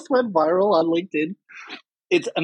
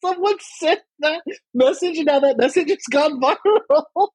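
laughing; 12 semitones higher than usual. Real, not acted. Laughing at viral message.

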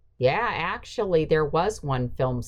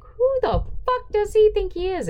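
outraged exclamation; 4 dB louder than usual. Retold or imitated. Outraged at colleague poked in chest.

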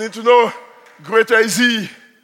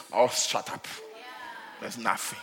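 soft, lower, fading brush-off; dismissive scoff; 7 semitones lower, 10 dB quieter than usual. Retold or imitated. Family tired of false snake alarms, dismissive scoff.

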